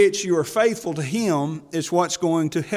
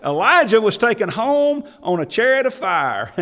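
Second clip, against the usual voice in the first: raised, high preaching voice; 7 semitones higher, 5 dB louder than usual. Acted. Preacher booming to the congregation about Elijah.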